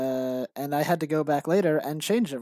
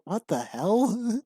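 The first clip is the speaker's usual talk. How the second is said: baffled reaction; 6 semitones higher, much slower than usual. Retold or imitated. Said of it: "And I was like".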